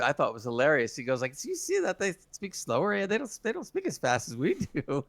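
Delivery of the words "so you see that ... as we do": higher-pitched mock remark; put-on, high teasing voice; mocking imitation. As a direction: imitate a cartoon character poking fun. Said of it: "so to make fun of it".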